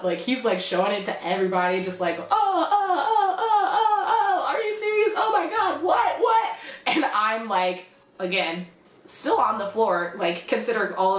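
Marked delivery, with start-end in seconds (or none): 2.2-6.6 high-pitched, laughing string of mock-stunned cries; milk the outrage for everyone watching; hammed-up shock. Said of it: "like, hamming it up".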